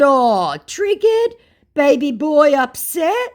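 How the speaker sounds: very high, loud mocking questions; baby-boy taunt. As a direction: tease him like a baby. Taunting the angry scammer.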